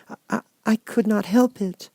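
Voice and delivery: put-on a meek little girl finding courage; little Dorothy. Dorothy stammers.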